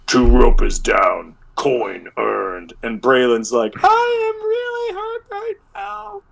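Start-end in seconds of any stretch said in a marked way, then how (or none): 0.1-2.7 slow, chest-out boast; a hint of a stoic warrior; proud wounded hero. Braylon puffs chest up proudly. 3.8-6.2 high, slow, wailing complaint; hammed-up pained cry, very high; whining hurt. Braylon admits pain.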